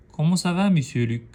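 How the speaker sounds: eager classroom hello; lively greeting. Shown as a demonstration. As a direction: greet him with enthusiasm, pitch up. Teacher models lively greeting.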